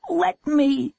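very high, urgent cry; high-pitched boy's protest; desperate demand. A narrator's reading. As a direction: plead frantically for the phone. Boy struggles for the phone.

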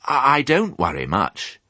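high, hesitant little pig; puzzled piglet. Acted. Piglet misunderstanding the ewe, high and hesitant. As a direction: stammer slightly as an unsure piglet.

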